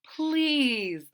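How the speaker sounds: usual speaking voice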